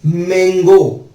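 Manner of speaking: Mango said very slowly and deliberately; slow demo word. Shown as a demonstration. Teacher to class, drawing out mango very slowly. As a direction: draw out mango syllable by syllable. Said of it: "क्या बन जाता है ये?".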